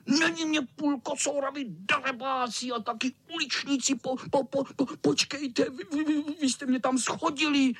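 very high, stuttering cat voice; high offended-cat stammer; indignant tomcat. Acted. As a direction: scold them in a high chattering whine. Cat indignantly scolds the boys.

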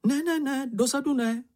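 high-pitched, anxious refusal; frightened protest. A narrator's reading. Čenich refuses to watch behind him, frightened high protest. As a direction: squeal a timid no in a small high voice. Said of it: "bránil se Čenich ustrašeně".

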